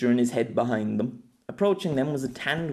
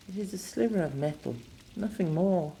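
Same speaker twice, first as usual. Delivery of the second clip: higher, softened woman's voice; pained, quiet woman; 7 semitones higher, 4 dB quieter than usual. Acted. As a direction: play her pained and soft, pitched up. Pained woman pleads with lover.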